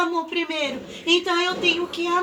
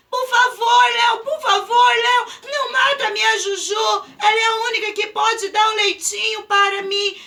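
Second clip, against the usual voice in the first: desperate begging; 4 semitones higher than usual. Acted. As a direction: beg him to spare her. Pedro begging Léo.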